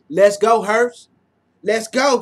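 ordinary talk, nothing marked

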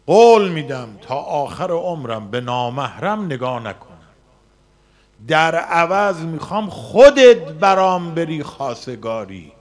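retold prayer at the Kaaba, loud and fervent; loud fervent plea. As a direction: promise loudly, pleading with God. Retold vow to God.

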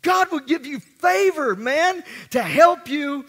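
rally the congregation, building to a shout; preaching at full force. Acted. Preaching crescendo.

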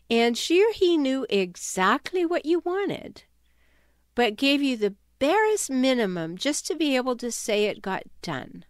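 ordinary talk

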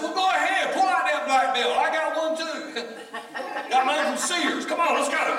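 high, loud mock challenge with laughter; laughing cocky taunt. Pastor daring the teen to fight, loud and laughing. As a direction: goad him, half laughing at yourself.

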